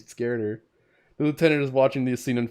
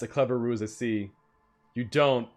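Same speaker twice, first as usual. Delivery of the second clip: ordinary talk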